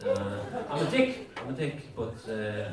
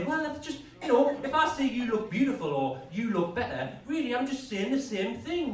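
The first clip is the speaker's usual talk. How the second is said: defending himself; 10 semitones higher than usual. Retold or imitated. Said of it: "I was trying to say".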